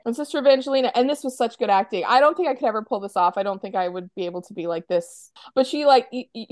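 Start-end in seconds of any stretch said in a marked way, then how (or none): none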